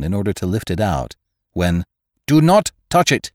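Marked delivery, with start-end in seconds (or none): 2.3-3.3 sharp high warning cry. A narrator's reading. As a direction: shout it as a sudden warning. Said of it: "cried the doctor".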